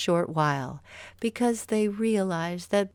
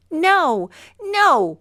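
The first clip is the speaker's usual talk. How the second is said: very high, loud frantic no; a boy pleading, shrieking in protest; crying out; 11 semitones higher, 9 dB louder than usual. Acted. Mowgli refuses Bagheera's village plan, very high loud cry. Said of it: "Mowgli cried".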